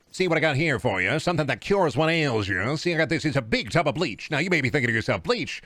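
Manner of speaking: transatlantic snake-oil pitchman; smooth patter with swooping old-time inflection; old-timey radio salesman. Pretend. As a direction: sell it like a vintage radio huckster. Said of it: "That voice generally makes me sold".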